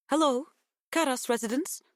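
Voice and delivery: put-on high-pitched Greek-accented woman answering the phone. A narrator's reading. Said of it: "softened by a Greek accent".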